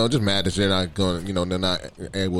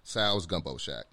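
ad read; 3 semitones higher, 5 dB quieter than usual. Acted. Reads a sponsor ad.

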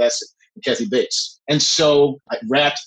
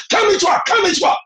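mock yell, pitched way up; Kathy Bates. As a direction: yell an impatient order in imitation. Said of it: "Kathy yells".

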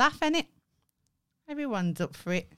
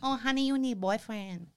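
put-on Thai accent; 4 semitones higher, 5 dB quieter than usual. Fans asked for her Thai accent, done playful. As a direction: tease like a Thai nail-salon worker. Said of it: "asking me to do my Thai accent".

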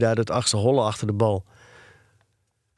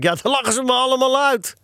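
put-on high-pitched boyish wail; plaintive, worked-up protest; upset objection; 12 semitones higher than usual. A narrator's reading. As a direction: object as if dreading the shame. Acted boy objecting in distress.

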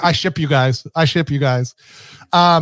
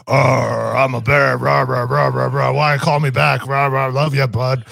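friend imitated as a talking bear; silly mock-bear babble, growled arr; Cliff; 3 semitones lower, slower than usual. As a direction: put on a rumbly, rah-rah bear voice. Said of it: "talk like Cliff does".

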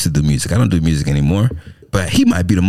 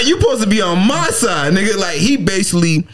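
ordinary delivery

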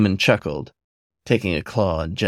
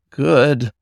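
high, louder flustered good; breathless good; 6 semitones higher, 5 dB louder than usual. A narrator's reading. Otter answering the demon, high and flustered. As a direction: blurt it out excitedly.

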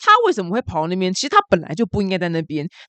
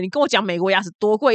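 ordinary talk, nothing marked

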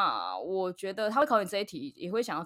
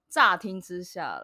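乍听之下啦 drawn out slowly and louder; emphatic; 6 dB louder, slower than usual. Real, not acted. Co-host hedging before giving opinions, 乍听之下啦 stressed and stretched. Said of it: "我要强调".

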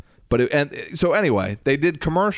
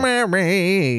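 high, stretched trombone-like croon; 8 semitones higher than usual. Retold or imitated. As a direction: moan it like a sad trombone. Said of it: "trombone voice where the guy's like".

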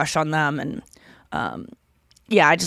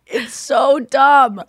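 ordinary talk

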